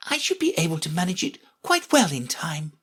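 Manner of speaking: a hint of a girl reassuring herself. Alice to herself about queenship, lightly lifted voice.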